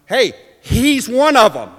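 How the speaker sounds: high, loud accusing call; calling out an accusation. Acted. Servant girl pointing Peter out to bystanders, calling out. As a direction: alert everyone to him.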